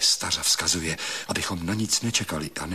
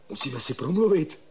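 higher-pitched insistence; insistent; 10 semitones higher, faster than usual. Acted. Suitor calls to his sweetheart outside, insistent. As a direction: press to be let in and heard. Urgent plea at Hanička's door.

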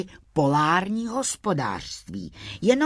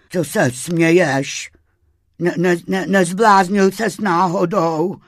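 loud, alarmed stammer with a shiver; shivering Vavřinec; 4 semitones higher, 8 dB louder than usual. A narrator's reading. Crayfish asks why his friend laughs, shivering. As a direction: chatter it out, trembling and bewildered. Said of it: "tetelil se vavřinec".

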